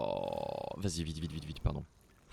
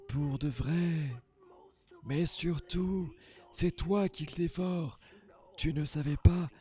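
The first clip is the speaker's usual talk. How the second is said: high, slow, mock-mystical seer voice; eerie prophetess voice; 11 semitones higher, 6 dB louder, slower than usual. Player voicing the game's seer, high and theatrical. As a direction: intone it like a dotty fortune-teller. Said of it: "je vois à qui elle me fait penser, cette voix".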